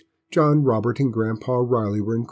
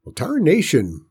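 put-on higher, folksy old grandpa; 6 semitones higher than usual. A narrator's reading. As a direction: grumble it like a country grandpa. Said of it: "Grandpa Riley repeated".

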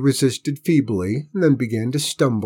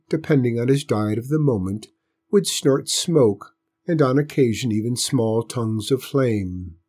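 plain reading voice

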